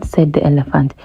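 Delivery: usual speaking voice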